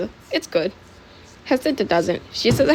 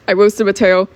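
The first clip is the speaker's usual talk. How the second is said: ordinary delivery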